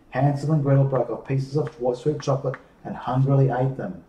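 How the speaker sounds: usual speaking voice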